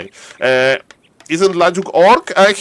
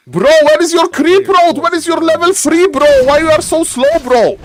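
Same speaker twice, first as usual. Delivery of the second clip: high, loud exasperated yell at the player; triggered yelling; 12 semitones higher, 4 dB louder than usual. Acted. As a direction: shout bro at him like you're fed up. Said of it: "I'm getting triggered here".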